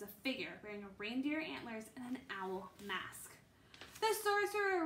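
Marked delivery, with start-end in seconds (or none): none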